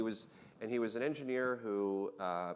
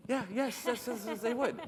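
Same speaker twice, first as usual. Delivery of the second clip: high, louder, rapid-fire yeses; excited agreement; 14 semitones higher, 5 dB louder than usual. Retold or imitated. Founder assures engineer journalists would want it, excited agreement. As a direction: agree over-eagerly, voice pitched way up. Retells eager yes to engineer.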